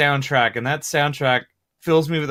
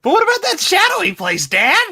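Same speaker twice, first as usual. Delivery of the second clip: put-on much higher, kid-like voice; young Simba voice; 4 dB louder than usual. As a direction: play the eager young cub. Young Simba quoted, high voice.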